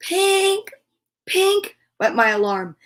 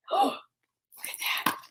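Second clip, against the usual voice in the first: gasp then whisper; 12 dB quieter, much faster than usual. Acted. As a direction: gasp and hush look at that. Reader spots the note.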